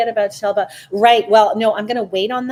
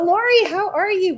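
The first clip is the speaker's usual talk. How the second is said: excited greeting; 9 semitones higher, 4 dB louder than usual. Real, not acted. Greets viewer Lori excitedly.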